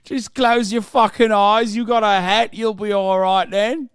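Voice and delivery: loud, exaggerated British accent, words stretched out; mock British officer. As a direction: bellow it like a cartoon Brit. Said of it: "They were like".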